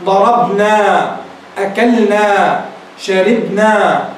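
"ضربنا" "أكلنا" "شربنا" each said slowly with a falling end; slow example words. Shown as a demonstration. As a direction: read the examples slowly for the students. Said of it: "مثال ذلك". (نطق بطيء للأمثلة)

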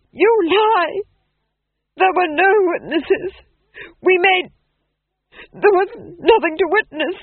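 frantic, high, loud denial; screamed. A narrator's reading. Duke denies there was any witness, screaming. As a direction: shriek it in rising panic. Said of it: "screamed the Duke".

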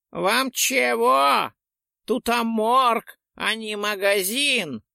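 put-on high, slow, squeaky old man voice. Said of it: "протянул неожиданно писклявым голосом".

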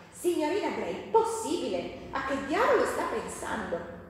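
an irate father's harsh rebuke; harsh exclamation. Acted. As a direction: scold her sharply like an outraged master. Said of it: "udì una voce aspra dietro di me esclamare".

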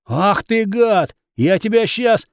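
furious. A narrator's reading. Swordsman lunges in rage.

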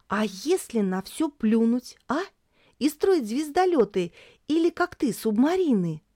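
put-on high eager girl's voice; young girl asking, lively; child voice. A narrator's reading. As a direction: pitch up as a pleading child. Tori eagerly asks grandfather.

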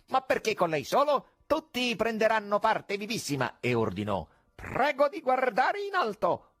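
mocking stage magician; conjurer's call. A narrator's reading. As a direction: toss it out to the stalls.

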